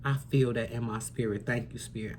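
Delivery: almost choking up, thanking spirit; emotional. Real, not acted. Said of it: "I'm getting emotional right now".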